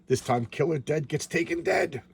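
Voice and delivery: ordinary delivery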